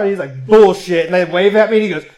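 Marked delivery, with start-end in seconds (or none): 0.5-1.1 loud, incredulous retort; imitated disbelief. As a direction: act out stunned refusal to believe it. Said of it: "like, in disbelief".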